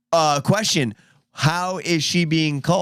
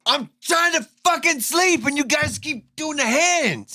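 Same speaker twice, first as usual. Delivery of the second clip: angry neighbor; 11 semitones higher than usual. Pretend.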